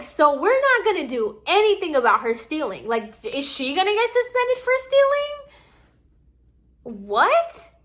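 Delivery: incredulous protest. Retold or imitated. Student protesting to the manager, high incredulous outrage. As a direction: throw it back at her in disbelief, pitch climbing high.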